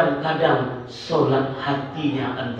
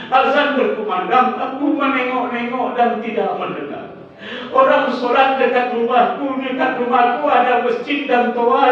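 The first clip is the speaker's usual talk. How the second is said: higher, pleading corpse begging for one more chance; pleading dead man; 9 semitones higher, 4 dB louder than usual. Acted. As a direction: beg Allah for just one more chance. Dead man begs Allah for return. (jenazah merintih memohon kepada Allah)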